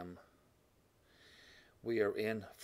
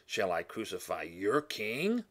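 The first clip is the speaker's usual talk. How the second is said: taunting dig; 2 semitones higher, 7 dB louder, slower than usual. Retold or imitated. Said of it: "Pilate's getting his digs in here".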